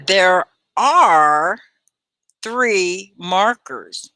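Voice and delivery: slow, stretched teacher model; slow stressed drill voice; slow model sentence. Shown as a demonstration. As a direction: say each word slowly for the class to copy. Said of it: "Can you say this?".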